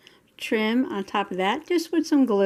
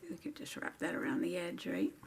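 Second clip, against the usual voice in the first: soft unvoiced whisper to herself; 12 dB quieter than usual. Real, not acted. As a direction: whisper the idea as you work. Muttering to self while wrapping.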